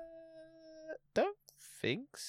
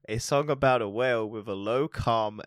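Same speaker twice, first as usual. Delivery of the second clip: ordinary talk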